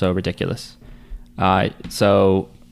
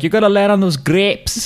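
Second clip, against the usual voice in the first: put-on higher Scottish kid with rolled R; Scottish accent; 9 semitones higher than usual. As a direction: roll the R hard like a Scottish kid. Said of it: "the R was rolled so hard".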